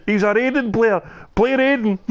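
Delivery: high-pitched excited commentator yell, lightly imitated; mock screaming. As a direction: imitate commentators shrieking in disbelief, without full volume. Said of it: "those two were just screaming".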